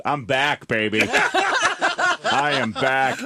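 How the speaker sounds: hammed-up, drawn-out comeback announcement; mock triumphant dad. Dad in hotel robe declaring his comeback, mock triumphant. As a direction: crow it loudly like a man set free.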